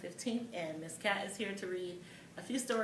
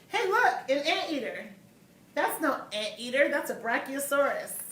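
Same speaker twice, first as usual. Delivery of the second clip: lively storybook voices, higher and louder; reading to children; 4 semitones higher, 5 dB louder than usual. Acted. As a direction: act out two kids bickering for little listeners. Continues the duck-rabbit argument.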